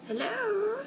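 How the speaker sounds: high bright hello; sing-song hello. Real, not acted. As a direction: greet in a lilting tone. Sing-song greeting to camera.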